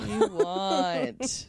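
imitating Andrew. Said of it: "He's like".

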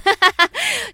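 short burst of laughter; bright laugh. Real, not acted. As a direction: laugh out loud. Laughs mid reading message.

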